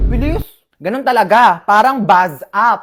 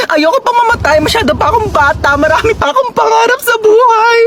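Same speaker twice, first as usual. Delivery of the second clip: high-pitched mock-crying plea; theatrical tearful wail, very high; hammed-up pleading; 11 semitones higher than usual. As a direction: beg for your life like an overacting amateur. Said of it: "Okay ba yung acting ko?".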